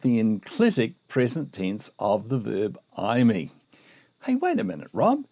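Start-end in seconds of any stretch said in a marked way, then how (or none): 4.2-5.2 high-pitched mock heckler voice; interrupting viewer. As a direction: butt in as a pretend listener, pitched way up. Acts out viewer interrupting the teacher.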